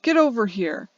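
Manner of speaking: loud menacing yell; man shouting at Alex, angry shout. Acted. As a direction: roar at the frozen child to come. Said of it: "the man shouts once more".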